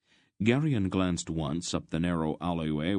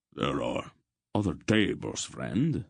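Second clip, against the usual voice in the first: cold. A narrator's reading. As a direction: warn the stranger off coldly. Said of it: "Mandoralin said coldly".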